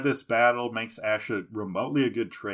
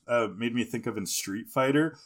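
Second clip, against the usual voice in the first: normal talking voice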